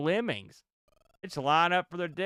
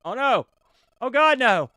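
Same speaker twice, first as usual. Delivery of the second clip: louder, higher panicked outcry; alarmed cry; 10 semitones higher, 10 dB louder than usual. Real, not acted. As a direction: sound the alarm. Character in danger.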